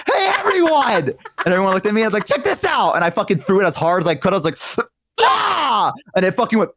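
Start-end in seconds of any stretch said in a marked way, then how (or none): 0.1-1.0 high, laughing shout for attention; laughing call-out. Retold or imitated. Kid calling the class to watch his frog throw, laughing shout. 2.3-2.9 high, showy call to the crowd; excited call. Retold or imitated. Kid showing off to classmates, high excited call. 5.2-5.9 high, short effort yell; throwing yell. Retold or imitated. Kid hurling the frog at the window, high yell.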